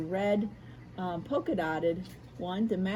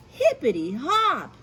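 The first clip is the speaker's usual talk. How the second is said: louder, higher bunny cheer; excited exclamation; 5 semitones higher, 9 dB louder than usual. Acted. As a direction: burst out with joy. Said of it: "Hoppy exclaimed".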